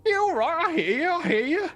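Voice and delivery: high-pitched, unimpressed goblin; goblin leader. Acted. Goblin leader answering the booming demand, high goblin voice. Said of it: "But the leader goes".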